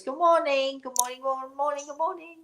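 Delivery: lilting morning greeting, going up and up; sing-song greeting. Real, not acted. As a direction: sing out a cheery hello to everyone.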